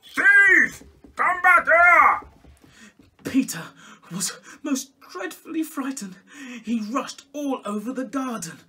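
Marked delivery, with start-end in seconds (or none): 0.0-2.3 full-force shout, much higher and louder; calling out. Acted. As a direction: bellow after the thief at the top of your voice. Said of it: "calling out".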